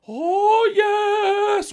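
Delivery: put-on a high, loud, drunken wail of delight; drunk mock rapture. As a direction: ham up a plastered guy crowing over the urn. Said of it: "was going".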